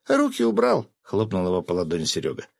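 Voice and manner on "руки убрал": very high, indignant female voice; sharp rebuke. A narrator's reading. Seryoga slapping the groping hand, sharp rebuke. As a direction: warn them off in a high pitch.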